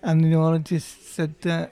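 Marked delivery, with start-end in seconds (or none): none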